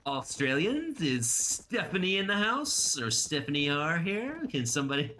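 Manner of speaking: high, rising roll-call questions. Real, not acted. Streamer asking if an Australian viewer is in, high searching call. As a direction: draw it out high, searching for someone.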